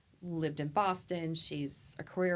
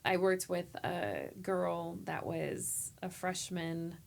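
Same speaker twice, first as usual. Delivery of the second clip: ordinary talk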